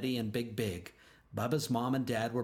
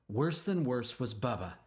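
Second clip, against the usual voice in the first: plain reading voice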